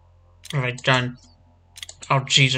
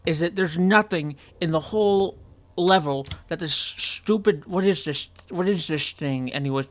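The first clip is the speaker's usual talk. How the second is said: usual speaking voice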